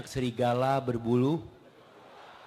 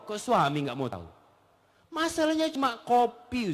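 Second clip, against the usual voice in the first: ordinary talk, nothing marked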